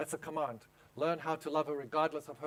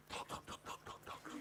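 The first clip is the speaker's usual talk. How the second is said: whispered, much softer chant of talk; mock whisper; 17 dB quieter than usual. Mocking women's chatter to the class in a whisper. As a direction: breathe it out like endless chatter.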